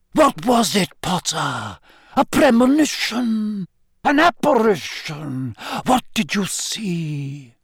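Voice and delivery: high, theatrical, eager questioning; thrilled professor. Acted. Trelawney questioning Harry on his vision, excited and theatrical. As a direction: press for the vision with swooping pitch. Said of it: "looking thoroughly excited".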